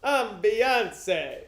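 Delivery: hammed-up Italian accent, punched out; Italian guy. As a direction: shout it like an excited Italian. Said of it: "sounds like an Italian guy saying".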